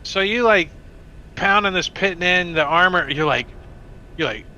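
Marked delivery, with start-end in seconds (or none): none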